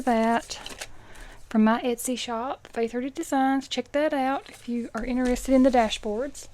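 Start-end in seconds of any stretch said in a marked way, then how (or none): none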